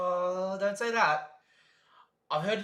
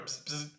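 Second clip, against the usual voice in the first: radio buzz; 8 dB quieter than usual. Retold or imitated. Imitates radio static.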